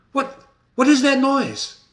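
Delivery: sudden high alarm; teacher voicing startled Macbeth. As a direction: jump at the sound. Macbeth hears women cry.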